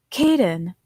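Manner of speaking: ordinary delivery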